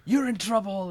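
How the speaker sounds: loud, high imitated telling-off; mock scolding. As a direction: act out an angry dad's scolding. Dad scolding, retold.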